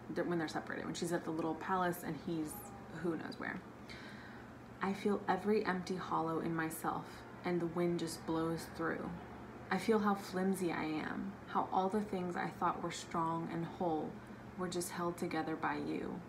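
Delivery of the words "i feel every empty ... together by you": soft, quiet reading of a tender letter; reading a quote. Acted. Vlogger reading a favorite book quote softly, quiet and tender. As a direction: read it gently, like a love letter.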